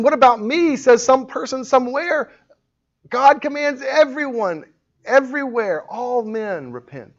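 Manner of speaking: high, insistent pulpit proclamation; forceful preaching. Real, not acted. Preacher declaring God's command to repent, forceful and high.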